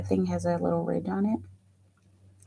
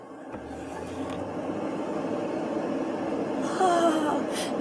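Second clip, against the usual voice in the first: dramatic sigh; 9 semitones higher, 3 dB quieter than usual. Real, not acted. Anticipating news from chat.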